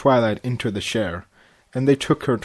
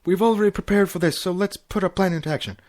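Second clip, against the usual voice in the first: fast, higher nervous rush; husband rushing through the plan; hurried; 7 semitones higher, faster than usual. A narrator's reading. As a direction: rattle the plan off in a panic. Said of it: "said panically".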